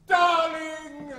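an over-the-top, loud, pleading "Darling!"; passionate cry. Acted. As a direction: fling the word out at her. Passionate outburst to Katisha.

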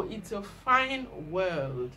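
ordinary delivery

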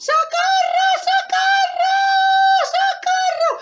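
very high, loud cries; screaming for help. Acted. Girl heard by the hunter, high screams for help. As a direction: shriek each word in hammed-up panic. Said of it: "está pidiendo socorro".

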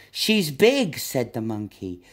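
plain reading voice

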